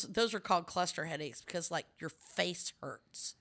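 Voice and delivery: normal talking voice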